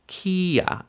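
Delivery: kia said high and apart; stressed syllable. Shown as a demonstration. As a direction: isolate and lift kia. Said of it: "that's where the stress is gonna be".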